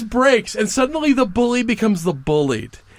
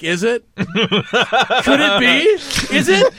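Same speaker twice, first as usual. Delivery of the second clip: mock excitement; 3 semitones higher, much slower than usual. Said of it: "I'm like".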